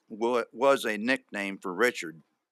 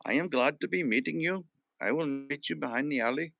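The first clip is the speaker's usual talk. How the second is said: higher, badly faked foreign accent; wrong accent for Helga; 5 semitones higher than usual. Introducing himself to chat as Helga from Finland, badly faked accent. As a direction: put on a mistaken Finnish accent, pitched up. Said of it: "Oh, wait, wrong accent".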